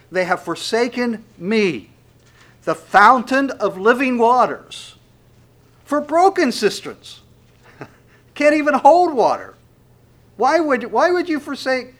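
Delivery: loud, high, impassioned scripture reading; preaching at full force. Acted. Preacher to his congregation, loud impassioned preaching.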